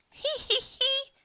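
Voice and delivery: very high, acted little giggle. A narrator's reading. Polly laughing at Froggy's fall, giggling. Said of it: "giggled Polly".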